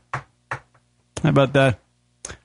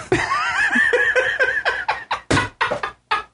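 high burst of laughter. Real, not acted. Burst of laughter after the joke.

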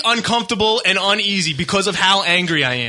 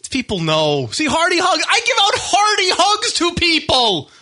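worked up; 11 semitones higher than usual. Real, not acted. Defending himself, worked up.

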